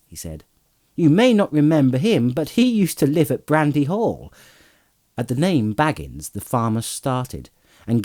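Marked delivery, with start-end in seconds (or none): 0.9-4.3 lightly voiced hobbit, higher pitch; cheerful young Pippin. A narrator's reading. Pippin introducing Frodo to the farmer, lightly voiced higher. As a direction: introduce a friend in a raised, lighter voice.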